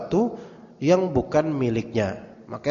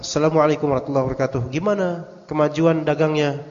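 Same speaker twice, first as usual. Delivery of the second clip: fast, run-together salam as if typed out; quick mechanical message greeting; rattled-off greeting. Retold or imitated. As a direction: rush through the greeting like a routine message.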